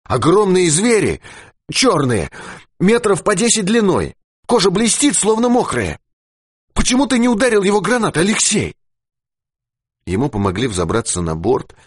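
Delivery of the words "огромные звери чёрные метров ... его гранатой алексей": high, feverish babble; delirious high-pitched talk; confused rambling. A narrator's reading. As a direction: blurt it out, muddled and agitated. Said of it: "путанно заговорил Дауги".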